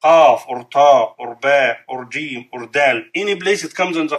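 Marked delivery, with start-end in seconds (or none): none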